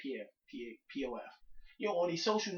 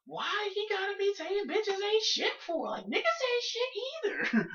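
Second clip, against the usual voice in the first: high, slow indignant complaint; mock offended woman; 4 dB louder than usual. Women angry about a [name] song, mocked in a high whine. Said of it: "they got mad about that".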